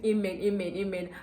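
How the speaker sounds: ordinary talk, nothing marked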